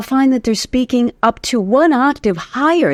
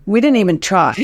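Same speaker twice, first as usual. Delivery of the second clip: normal talking voice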